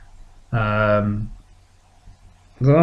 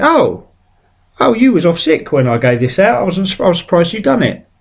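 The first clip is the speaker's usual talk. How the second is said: higher, lightly voiced surprised teacher; 7 semitones higher than usual. Teacher finding out the pupil did homework, mock surprised.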